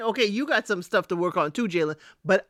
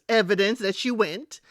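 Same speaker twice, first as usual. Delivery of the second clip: ordinary delivery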